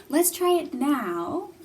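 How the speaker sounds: normal talking voice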